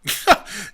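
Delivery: short throaty spitting noise; imitated loogie hawking. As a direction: hack up a pretend loogie. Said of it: "either someone spitting a loogie".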